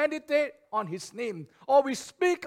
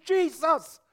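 high, raised cry to God; calling upon him; 6 semitones higher than usual. Acted. Preacher voices early church calling upon Jesus, crying out. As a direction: call out the name for help. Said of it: "they called upon him".

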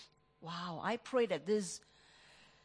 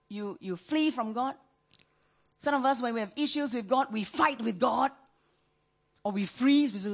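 usual speaking voice

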